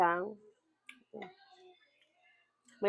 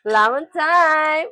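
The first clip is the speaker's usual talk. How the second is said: excited announcement; 3 semitones higher, 10 dB louder, much slower than usual. Real, not acted. Announcing the food segment.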